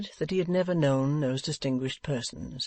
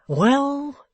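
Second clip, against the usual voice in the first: snapped, much higher and louder Well; snappish; 12 semitones higher, 7 dB louder than usual. A narrator's reading. Venus losing patience with Wegg, snappish. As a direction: bite it off sharply. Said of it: "retorted Venus snappishly".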